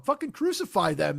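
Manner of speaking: usual speaking voice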